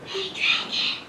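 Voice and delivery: slow, hushed whisper. Acted. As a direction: call him under your breath. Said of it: "why are you speaking so softly".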